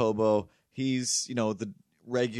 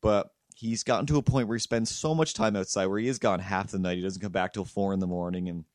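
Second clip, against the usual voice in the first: ordinary talk, nothing marked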